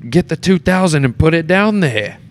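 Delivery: high, loud mock yell in my face; hammed-up stage scream at a castmate; retold scream. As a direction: bellow it like a huge actor losing it onstage. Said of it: "he screams at me".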